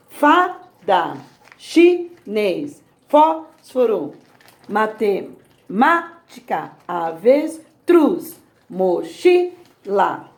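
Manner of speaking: each word broken slowly: FA-da, chi-NÊS, FÓS-foro; tonic syllables stressed. Shown as a demonstration. Teacher reading gapped words to pupils, each tonic syllable stressed apart. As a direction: gaps between syllables, punching FA, NÊS, FÓS, MÁ, TRUZ, CHI. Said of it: "estão faltando as sílabas tônicas".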